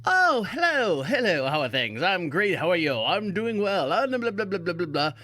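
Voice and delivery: breezy pretend phone call, chatty and careless; mock phone chat. Mocking someone who takes a call in dirty gloves, with a lightly voiced chatty hello. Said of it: "and you're, like, going".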